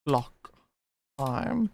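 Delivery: usual speaking voice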